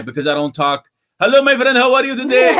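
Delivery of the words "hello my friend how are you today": high, loud hammed-up greeting; big mock-accent hello; put-on foreign shopkeeper. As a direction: play an over-friendly accented shopkeeper. Mocked accent bit in an anecdote.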